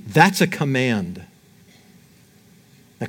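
normal talking voice

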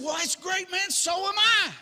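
very high, gleeful acted outburst; delighted agreement. Rescuer delighted at shared faith.

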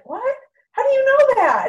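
sharp high jump, loud; shocked disbelief. Retold or imitated. Retelling her shock to blind friend, high loud disbelief. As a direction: shoot the question back in total astonishment.

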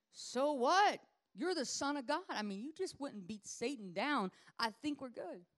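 loud, high protest; indignant disciple. Acted. Disciples protesting Jesus' plan, loud and high. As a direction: push back hard on Jesus.

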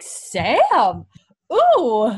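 high, loud delighted coo; swooning. Real, not acted. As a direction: swoon at the romantic line. Swooning reaction to the scene.

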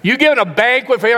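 high, loud, fast indignant outburst; loud older brother. Retold or imitated. Older brother yelling at his father over the banquet, loud. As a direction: shout it in outrage at the father. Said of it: "the older brother's loud".

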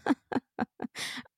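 quiet, unvoiced mock snicker; imitated dragon snicker. Imitating the dragon laughing at you, mock snicker. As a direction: snicker at someone like they're an idiot. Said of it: "he just snickers at you".

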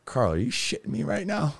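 can't believe what he just heard; to the roommate who confessed he's a psychologist, incredulous. Retold or imitated. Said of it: "And I was like".

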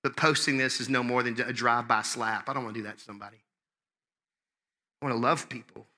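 usual speaking voice

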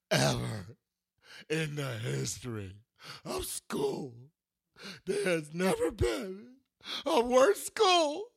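slow, high whine building to a tearful scream; a six-year-old's theatrical tantrum, rising to a shriek; child's dramatic meltdown. Retold or imitated. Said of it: "full-blown [name] meltdown".

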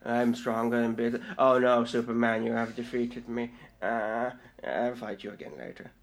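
low, soft, lazy villain defeat; megalomaniac rant. Retold or imitated. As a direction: shrug off defeat like a bored god. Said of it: "that's Darkseid".

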